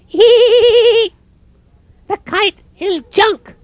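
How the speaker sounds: very high, squeaky bird titter; mocking robin giggle. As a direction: cackle down at Toad like a little bird. Robins laughing at Toad.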